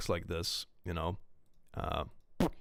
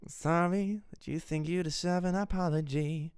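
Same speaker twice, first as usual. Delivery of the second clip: mock apology line; 7 semitones higher than usual. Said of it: "Oh yeah, I did one of that".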